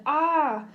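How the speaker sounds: put-on sudden high wailing cry like a baby; a loud, high baby's wail from the dark loft. A narrator's reading. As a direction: cry out like a startled infant. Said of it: "It sounded like a baby crying".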